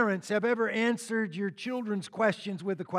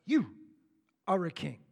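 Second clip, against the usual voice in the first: "You" punched alone, a pause, then "are a king" falling away; stress on YOU; 2 semitones lower than usual. Shown as a demonstration. Pilate challenging Jesus, with pointed stress on "You". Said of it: "He's emphasizing".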